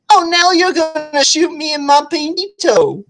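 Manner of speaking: mocking disbelief. Woman faces his gun, mocking disbelief.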